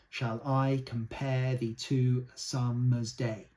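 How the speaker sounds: slow, measured Shall I compare thee, each beat weighted; slow, rhythmic sonnet reading; iambic recitation. Shown as a demonstration. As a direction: recite it slowly in pentameter. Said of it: "That's perfect. That is".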